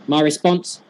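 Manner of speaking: ordinary talk, nothing marked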